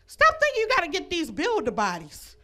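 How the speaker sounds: ordinary talk, nothing marked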